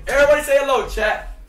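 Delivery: loud hype greeting to chat; streamer hype shout. Real, not acted. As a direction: shout it out to the viewers.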